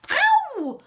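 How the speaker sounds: very high, loud yelp; not-deep yelp. A narrator's reading. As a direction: squeal it in a tiny high voice. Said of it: "that wasn't a very big".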